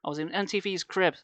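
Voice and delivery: ordinary delivery, nothing marked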